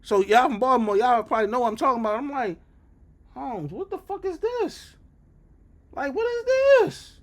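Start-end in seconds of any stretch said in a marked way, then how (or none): 3.3-7.2 slow, high-pitched squeal of disbelief; drawn-out incredulity. Retold or imitated. Acts out shock at the neighbourhood.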